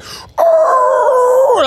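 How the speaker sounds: high, loud screech of bus brakes; shrill brake-noise imitation. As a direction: mimic the brakes squealing.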